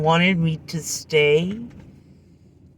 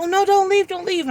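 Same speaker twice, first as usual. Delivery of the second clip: guy pleading; 14 semitones higher than usual. Retold or imitated. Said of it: "He's like".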